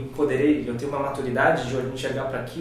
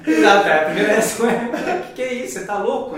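talking through laughter, pitched higher; laughing; 7 semitones higher, 7 dB louder than usual. Real, not acted. Podcast guest retelling a friend's reaction to ionized water, laughing. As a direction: push the words out while laughing.

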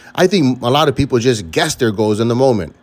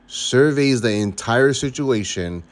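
ordinary delivery

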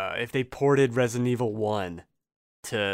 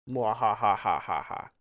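slow evil villain laugh; slow theatrical villain menace; 2 semitones lower than usual. Pretend. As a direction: gloat like a cartoon villain. Gloating over winning the pick.

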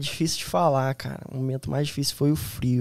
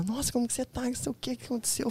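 high, soft worried female voice; hushed high-pitched concern, imitated; imitated woman; 9 semitones higher, 9 dB quieter than usual. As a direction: mimic her anxious questions in a small high voice.

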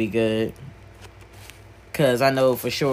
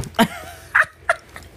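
high-pitched burst of laughter; amused laugh; 4 dB louder than usual. Real, not acted. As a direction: crack up at your own mistake. Laughs at their own spelling slip.

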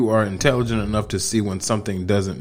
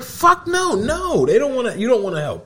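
very high, comic protest easing down; mock outraged refusal; 11 semitones higher than usual. Husband acts out refusing to help in garage, mock outrage. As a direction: squeal out a hammed-up no.

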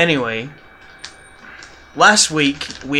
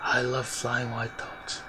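softer, slow, off-mic; far from mic; 3 semitones lower, 11 dB quieter, slower than usual. Real, not acted. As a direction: say it away from the microphone. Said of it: "lean into the mic".

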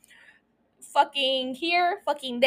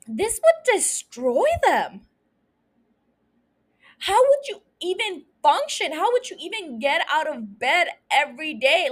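pitched up, emphatic and incredulous; aghast disbelief; 4 semitones higher than usual. Real, not acted. Host imagines a parent coping with such loss, aghast disbelief. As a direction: plead the impossibility of coping. Aghast at losing all six children.

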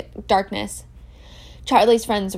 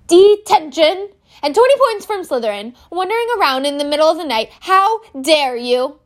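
much higher, louder stern teacher; furious McGonagall; 7 semitones higher, 7 dB louder than usual. Acted. Professor McGonagall catching Malfoy out of bed at night, furious and much louder. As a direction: scold the boy with outrage.

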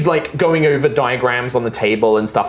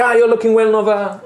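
louder, higher, warm French greeting; Araya greeting Nova; 7 semitones higher, 3 dB louder than usual. Acted. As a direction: greet her gladly, French accent. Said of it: "it's like".

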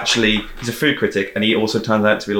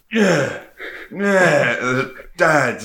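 drawn-out croaky Cockney, pitched up; 5 semitones higher, much slower than usual. Pretend. Mocking the son of Helen Mirren and Michael Caine, croaky Cockney. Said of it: "like, really, like, croaky Cockney".

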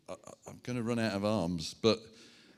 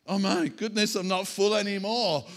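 higher, louder pretend dismay; mock alarm; 7 semitones higher, 8 dB louder than usual. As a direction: play someone suddenly finding they've run empty. Acts out someone running empty.

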